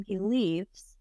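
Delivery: ordinary delivery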